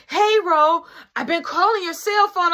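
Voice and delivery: high, loud put-on woman's voice on the phone; imitating baby mama. Baby mama calling the store phone, high loud imitation.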